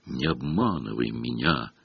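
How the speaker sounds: soft, low tearful plea; tearful breath. A narrator's reading. Myrtle in tears to Hermione, soft and low. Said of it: "выдохнула Миртл, заливаясь слезами".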